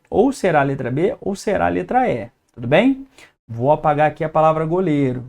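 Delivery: normal talking voice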